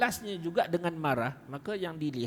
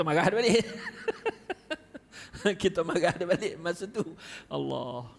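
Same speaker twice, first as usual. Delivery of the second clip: laughing; 3 semitones higher, 3 dB louder than usual. Real, not acted. Laughing at his own past temper.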